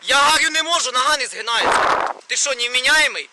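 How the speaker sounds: loud, high exasperated protest; angry protest. Real, not acted. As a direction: protest loudly at being pushed. Protesting that his leg won't bend.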